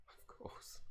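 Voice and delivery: hushed whispered aside; whispering a wry aside to viewers. Real, not acted. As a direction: breathe it under the voice.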